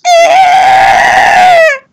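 slow, loud mock rant; yelled insult. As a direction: bellow it slowly at the friend. Friend yelling insult, slow.

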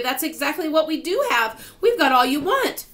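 high, loud delight; elated, high-pitched; excited exclamation. A narrator's reading. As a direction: burst out with the good news. Grasshopper excitedly has the answer.

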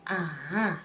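"Ah" dips low and rises again; falling-rising tone. Shown as a demonstration. Teacher demonstrates third tone, dipping "Ah". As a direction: sink into "Ah", then bring it up. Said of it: "is false and rise again".

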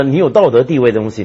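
usual speaking voice

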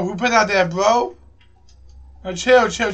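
ordinary delivery

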